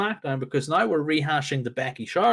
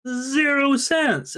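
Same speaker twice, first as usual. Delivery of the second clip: ordinary talk, nothing marked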